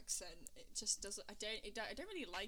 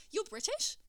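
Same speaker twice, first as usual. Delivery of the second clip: high, louder incredulous question; mock disbelief, pitched up; imitated surprise; 10 semitones higher, 6 dB louder than usual. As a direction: mimic someone astonished by the news. Mimics someone's surprised question.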